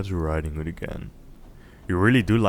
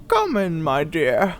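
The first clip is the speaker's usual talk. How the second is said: much higher, louder quavering granny; old granny; 9 semitones higher, 6 dB louder, slower than usual. Acted. Grandmother in bed answers a knock, high old voice. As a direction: call out like a frail old woman. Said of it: "said the old lady".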